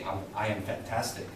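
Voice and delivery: low, lifeless delivery; low, half-hearted and passionless; unenthusiastic. Shown as a demonstration. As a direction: demonstrate saying it with no excitement. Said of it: "But don't say".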